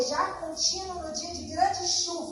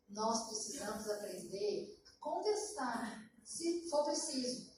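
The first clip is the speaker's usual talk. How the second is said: slow, softer, weighty; slow emphatic teaching; 3 semitones lower, 7 dB quieter, much slower than usual. Real, not acted. Preacher teaching women to object without grumbling, slow and weighty. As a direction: lay down the lesson word by word. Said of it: "preaching the lesson slowly".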